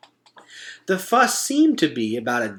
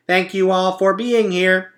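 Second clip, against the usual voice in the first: upbeat robot, higher and louder; a cheerful machine making a speech; 6 semitones higher, 5 dB louder than usual. A narrator's reading. Roz announcing the party truce to the animals, perky robot.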